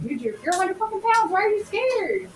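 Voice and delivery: exasperated at a big scared pet; mock exasperation. Owner retelling scolding his frightened pit bull, exasperated. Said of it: "I'm like, "Dude".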